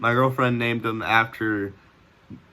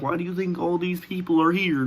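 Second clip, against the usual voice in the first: higher-pitched take on dad; imitating dad's voice; 6 semitones higher than usual. Son mimicking how his dad sounded, higher put-on voice. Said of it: "That's how he sounded".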